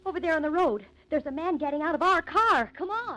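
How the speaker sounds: sudden alarm at the car; alarmed calling out. Acted. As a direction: point it out fast, startled. Spotting a stranger at their car.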